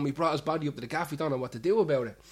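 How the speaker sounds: hurried, fast retelling; rushed quote. Retold or imitated. Barman quoting a friend's panicked call, rushed and fast. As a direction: rattle it off quickly. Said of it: "And he goes".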